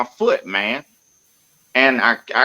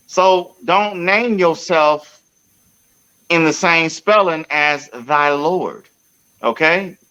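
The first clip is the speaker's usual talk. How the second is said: mock preachy; 4 semitones higher, slower than usual. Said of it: "mock sermon".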